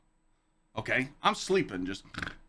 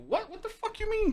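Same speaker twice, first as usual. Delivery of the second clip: incredulous outburst. Retold or imitated. Disbelief at being told to hide.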